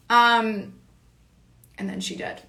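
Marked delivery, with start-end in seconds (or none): 1.8-2.4 soft whispered aside to herself. Real, not acted. To viewers, a sly whispered aside about herself. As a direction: drop to a breathy hush, sly.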